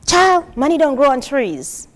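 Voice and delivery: loud, high motherly scold; a mother shutting down a Christmas wish; mother's scolding. Retold or imitated. Mother to child asking for gifts, loud scolding.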